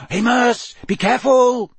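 high, loud caution; warning call. A narrator's reading. Wizards warn Amos as he marches off, warning call. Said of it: "said a few of the wizards warningly".

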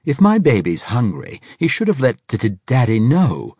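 high, loud doting stammer; baby-talk to the snake with a stutter; cooing old man. A narrator's reading. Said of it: "He cooed".

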